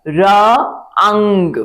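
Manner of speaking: र अंग very slow, each part drawn out; slow spelling out. Shown as a demonstration. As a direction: sound out the letters slowly for beginners. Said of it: "पहली line में लिखा है".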